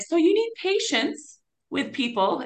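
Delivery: a hint of patient fatherly coaxing; lightly voiced Father. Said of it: "He was like".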